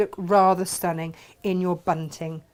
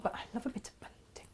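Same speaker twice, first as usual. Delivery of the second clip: whispering; 3 semitones higher, 18 dB quieter, faster than usual. Real, not acted. Aside remark.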